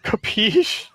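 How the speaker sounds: high, loud, incredulous mocking echo. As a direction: throw it back high, as a loud disbelieving question. Incredulously echoes the AI's failed accent.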